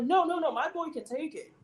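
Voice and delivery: lightly acted defensive insistence; defensive parent. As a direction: imitate a parent jumping in defensively. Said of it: "family would just get defensive".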